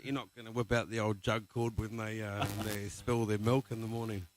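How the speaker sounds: ordinary delivery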